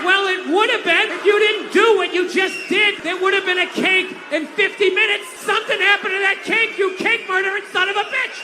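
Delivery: high, loud shouted retort; furious comic tirade. Acted. Baker yelling at the cake thrower, hammed-up fury. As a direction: escalate into hammed-up outrage.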